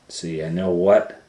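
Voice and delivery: plain reading voice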